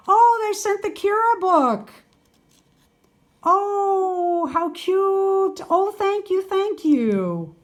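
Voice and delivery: high, gushing thanks; delighted surprise. Real, not acted. Vlogger finds the book in her parcel, high delighted gushing. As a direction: squeal with delight at the gift.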